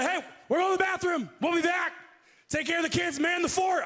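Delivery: hammed-up macho "we'll be back, man the fort"; manly guy talk. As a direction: pump it up like a macho buddy. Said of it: "Never felt less like a man".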